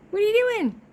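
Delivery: high, teasing voice to a pet; pet talk. Real, not acted. Catching her cat with its toy, high pet-talk voice. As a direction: fondly scold the cat in a high voice.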